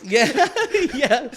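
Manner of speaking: high, loud laugh through yeah. Real, not acted. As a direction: crack up while agreeing. Laughing at veterans joke.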